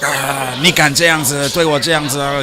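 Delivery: imitating an outraged customer's Chinese rant; mock screaming. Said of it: "she's screaming at me".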